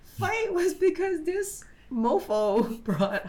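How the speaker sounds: high, squeaky laughter through the words; laughing while talking. Real, not acted. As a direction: barely get it out for laughing. Cracking up over their first fight.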